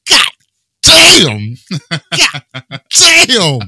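loud, high drill sergeant bark; drill sergeant shout. Retold or imitated. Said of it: "he's like".

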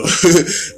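laugh. Real, not acted. Laughs mid story.

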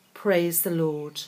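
normal talking voice

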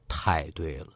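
ordinary delivery, nothing marked

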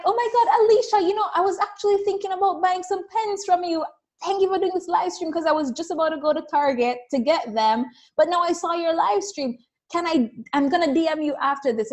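acted burst of high-pitched excitement; excited friend. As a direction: play an eager fan who just caught the livestream. Voicing an eager friend on livestream.

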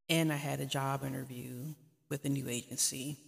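ordinary delivery

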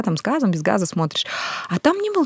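ordinary talk, nothing marked

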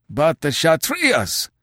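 a crowd of hillmen crying out in alarm; drawn-out cry of protest over the enemy's name; clamoring. A narrator's reading. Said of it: "clamored the men in the semicircle".

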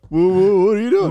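loud, high stammered question; stammering loud what. Pretend. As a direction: blurt the stutter out loud. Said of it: "that's like when we respond".

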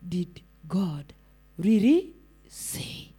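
low, soft and drawn-out; serpent's insinuating question. Acted. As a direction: quote the serpent slowly and quietly. Acting the serpent's opening question.

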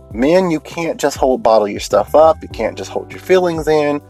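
normal talking voice

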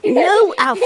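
loud high telling off; sharp no. Real, not acted. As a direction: stop him sharply. Scolding baby.

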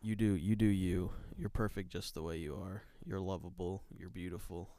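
soft, soothing reassurance; relaxing. Real, not acted. Co-host tells listeners they are lovable, relaxing soft voice. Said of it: "your voice is relaxing".

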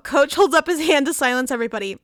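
usual speaking voice

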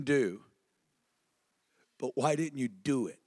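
grieving sister, understated; mild weeping plea. Retold or imitated. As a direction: plead softly through mild tears. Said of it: "She's gonna be weeping".

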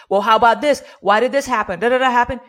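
loud, pushy customer questions; mock demanding customer. A business owner voicing an irate customer, loud and demanding. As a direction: bark questions like an annoyed customer.

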